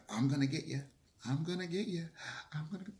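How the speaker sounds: a hint of a creepy stalker; lightly voiced creepy mukbang guy. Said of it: "this creepy ass F".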